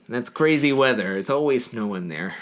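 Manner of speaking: put-on Upper Midwest small-talk accent; North Dakota accent. Diner regular leaving a missed-connection message, mock North Dakota accent. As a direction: chat like a hammed-up Minnesotan local. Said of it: "gonna talk like this in the accent".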